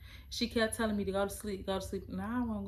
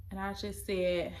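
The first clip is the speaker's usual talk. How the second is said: usual speaking voice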